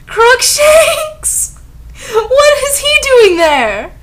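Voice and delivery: very high, loud, drawn-out disbelief; mock incredulous shriek. Reader baffled by the wrong spell, in a mock shriek. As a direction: squeal in exaggerated bafflement. Mockingly repeats the absurd spell.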